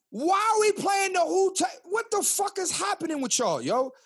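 exasperated yell at the dating games people play; exasperated outburst. Real, not acted. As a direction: throw up your hands in disbelief. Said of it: "exasperated outburst".

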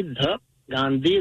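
"Gandhi" said slowly with a soft th, like "the"; slow Indian pronunciation of "Gandhi", soft th. Shown as a demonstration. Caller correcting hosts on "Gandhi", careful soft th. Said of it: "the way you pronounce T H E".

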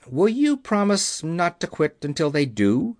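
plain reading voice

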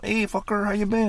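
high-pitched comic buddy hello; old friend's greeting. Acted. Old friend pops up, acted greeting.

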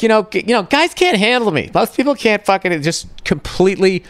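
high mocking imitation of a self-important woman; mock boastful woman. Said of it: "when women are just like".

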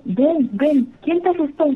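high, gentle coaxing call; soothing a scared child. Retold or imitated. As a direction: coax the frightened boy to come to you, pitched high. Sister comforts crying scared child.

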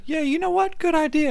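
very high mock-airhead voice; ditzy agreement. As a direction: squeak it out like a clueless girl. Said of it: "She's like".

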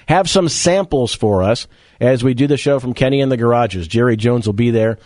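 ordinary talk, nothing marked

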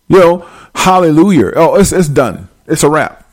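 ordinary delivery

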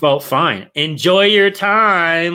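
high, drawn-out fake send-off; imitating a put-out partner; mock passive-aggressive girlfriend. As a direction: stretch it out, resentfully chirpy. Voices girlfriend resenting his golf outing.

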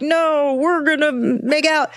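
high, insistent protest; hammed-up high voice overruling good sense; voice of pheromones. Expert playing the guest's pheromones overriding her profiling, high mock-defiant voice. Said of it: "pheromones were like".

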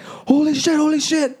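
high frightened yelp, repeated; panicked cry. Retold or imitated. Jumping on his friends after the door slams, high panicked cry. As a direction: shriek it in sudden fright.

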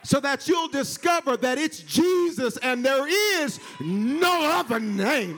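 high, loud, slow sung whoop; preaching at full force. Acted. Preacher lifting the church to its climax, sung. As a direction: ride the organ and whoop it.

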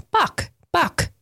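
put-on high, small child's voice; little girl babbling a name; toddler voice. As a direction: say it like a tiny toddler pointing. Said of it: "and she says".

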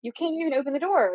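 high, fast insistent show-and-tell; urgent demonstration. Retold or imitated. Girl showing friends the blocked door, high and hurried. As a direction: prove to them the door won't budge. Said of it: "I was like".

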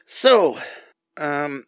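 normal talking voice

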